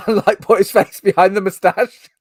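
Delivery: high, loud, cracking up mid-story; laughing while talking. Real, not acted. Telling friends about the fake mustache on a straw, laughing. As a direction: push the words out through laughter.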